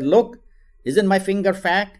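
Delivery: playful mother. Acted. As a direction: play along to show the child. Mother playfully shows the glass.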